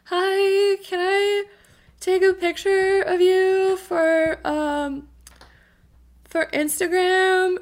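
high, slow shy asking voice; timid high voice. Retold or imitated. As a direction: ask sweetly and carefully. Timidly asking costumed man.

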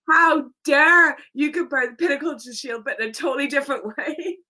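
hammed-up indignant yell, rushing the ending; mock indignation. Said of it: "And with me, it's like".

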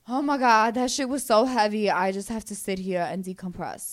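loud, dramatic overwhelmed sigh of a voice; mock overwhelmed. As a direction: ham up being overwhelmed. Mocking overwhelmed listener.

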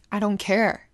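put-on a mom cutting her kid off, hard; pissed mom. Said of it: "You know, she was pissed".

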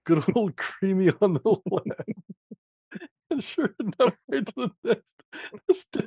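high, squeezed voice breaking into laughs; helpless laughter. Real, not acted. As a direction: try to read on while cracking up. Cracking up reading friend's crude message.

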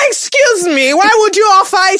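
high, affronted mock retort; mock indignation. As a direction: act out an offended comeback, pitched high. Acted retort to sister's offer.

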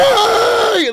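high, loud indignant shout; screaming at ref. Retold or imitated. Opponent screaming at the ref, loud high shout. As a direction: yell it at the referee. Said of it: "screaming at the ref".

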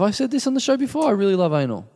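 high-pitched mimicry of co-host; mocking imitation. Co-host finishing the other's confession, mocking imitation. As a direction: mimic him, starting high and dropping. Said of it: "that's what you were gonna say".

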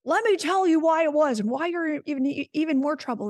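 lightly voiced scolding adult; lecturing adult. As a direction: double down like a self-righteous parent. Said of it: "And they're like".

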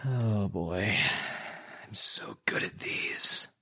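breathy whisper, slow and soft; whispered aside. Real, not acted. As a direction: whisper it to yourself, mock modest. Player mutters before a roll.

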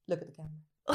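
very soft, low mimicry; imitated aside. Mother secretly directs her son mid-pose, hushed mock aside. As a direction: slip the instruction out under the breath. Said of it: "distraught face into the side of his".

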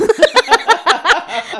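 high loud laugh bursting out; burst of laughter. Real, not acted. Big laugh at own joke.

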